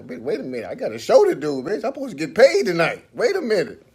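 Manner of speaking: acted outburst, rising to a high shout; alarmed protest. Performer protesting a death warning before his show, alarmed shout.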